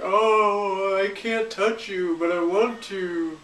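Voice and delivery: hammed-up, drawn-out longing; mock yearning. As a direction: imitate a melodramatic movie moment. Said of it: "They're like".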